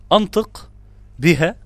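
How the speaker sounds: ordinary talk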